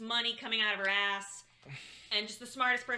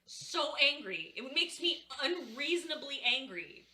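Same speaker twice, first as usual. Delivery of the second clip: ordinary delivery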